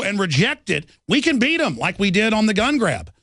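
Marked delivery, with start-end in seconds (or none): none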